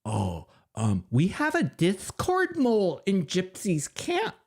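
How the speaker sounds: mock Becca's proud announcement; hammed-up high-voiced impression of Becca bragging; imitating Becca. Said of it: "And Becca says in that meeting".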